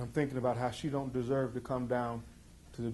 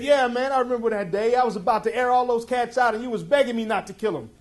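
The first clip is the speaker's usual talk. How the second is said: loud, much higher brash brother voice; brother Sean; 11 semitones higher, 11 dB louder than usual. Retold or imitated. Brother in prison recalling that day, loud brash boast. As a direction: boast it like the swaggering little brother. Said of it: "And he said".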